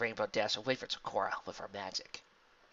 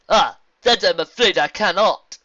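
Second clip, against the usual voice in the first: slow, loud, higher zebra voice; regretful zebra; 8 semitones higher, 14 dB louder than usual. Acted. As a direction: refuse with formal regret.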